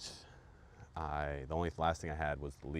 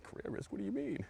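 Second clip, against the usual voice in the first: mock incredulous voice. Mocking audience disbelief.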